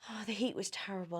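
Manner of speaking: plain reading voice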